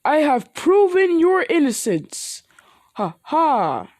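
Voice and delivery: high, loud, slow hero proclamation with a laugh; heroic proud voice. Acted. All Might telling Midoriya he proved his innocence, proud booming. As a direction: announce it like a triumphant hero.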